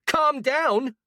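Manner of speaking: high screamed repeat; screaming. Acted. A furious woman screaming back at the young man. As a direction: throw the words back at him screaming. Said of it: "screaming those words at the top of her lungs".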